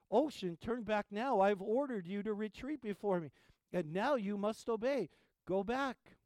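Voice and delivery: high, loud royal outburst; angry shout. Acted. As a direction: bark it at the waves like an offended king. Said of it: "Canute shouted".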